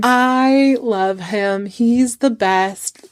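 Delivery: slow, drawn-out adoring musing; dreamy lovestruck. Acted. Bride thinking about her life in a field, dreamy. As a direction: stretch the words out like a lovesick daydream.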